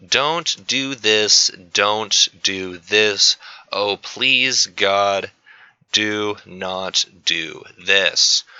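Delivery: slow, word-by-word mock begging; slow emphatic plea. Teacher begging students to avoid a mistake, slow emphatic plea. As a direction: spell out the warning very slowly.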